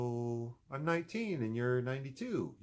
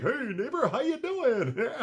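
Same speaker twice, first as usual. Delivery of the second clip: chirpy high hello with a laugh; laughing mock-friendly hello; cheery greeting; 11 semitones higher, 5 dB louder than usual. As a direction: greet the neighbor brightly. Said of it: "I'm like".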